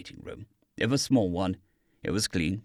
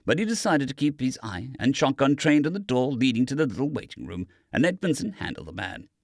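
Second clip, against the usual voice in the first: usual speaking voice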